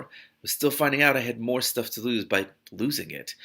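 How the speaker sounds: plain reading voice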